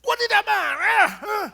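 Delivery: red-faced man shouting that he isn't angry; shouting denial. Retold or imitated. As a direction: bellow that you're not angry. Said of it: "Udah teriak".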